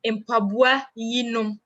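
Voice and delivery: "Mpaboa yi nom" said slowly, each word spaced out; teacher models the plural Twi phrase, slowly. Shown as a demonstration. Spell out "Mpaboa yi nom" slowly for learners. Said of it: "You just add nom".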